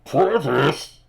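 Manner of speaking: the big voice, taken aback; booming voice questioning. Acted. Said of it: "The voice says".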